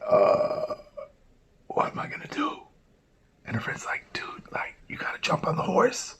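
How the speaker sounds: hushed unvoiced whisper. Two girls whispering about jumping on, hushed. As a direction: whisper it so the Prophet can't hear.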